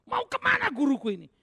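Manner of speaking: high, loud, bewildered cry as Peter; disappointed Peter. Acted. Peter asks where his teacher is going, high and dismayed. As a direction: let Peter's letdown burst out. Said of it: "itu membuat Petrus menjadi kecewa".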